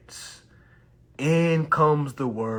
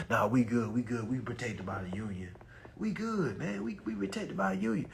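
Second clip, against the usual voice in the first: lunch-break worker talk; 3 semitones lower, 8 dB quieter than usual. Retold or imitated. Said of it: "they talking like this at, on lunch break".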